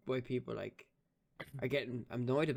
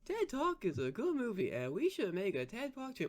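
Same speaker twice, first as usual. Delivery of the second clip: much higher, squeaky Mark Wahlberg voice; high squeaky Boston tough guy; Mark Wahlberg impression; 10 semitones higher than usual. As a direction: squeak it out like a movie star pitching a sequel. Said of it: "what's my Mark Wahlberg impression?".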